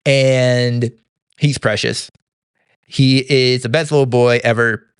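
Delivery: ordinary talk, nothing marked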